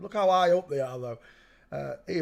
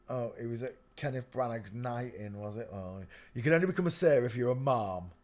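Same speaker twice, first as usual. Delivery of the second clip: ordinary talk, nothing marked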